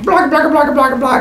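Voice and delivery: high, babbling monster noise; charging monster. Acted. Monster runs at the man, high mock babbling. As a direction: gabble like an attacking cartoon monster. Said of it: "the monster runs at him going".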